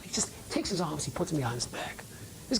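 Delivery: usual speaking voice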